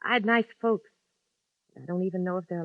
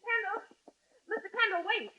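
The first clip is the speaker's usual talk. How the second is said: high, urgent call from a distance; woman calling a man down the street; calling after him; 5 dB quieter than usual. Acted. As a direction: call him back before he goes. Woman calls after a man leaving.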